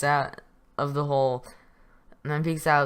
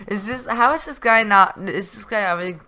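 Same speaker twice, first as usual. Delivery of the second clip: incredulous; 7 semitones higher, 8 dB louder than usual. Real, not acted. Laughing disbelief at missed shots.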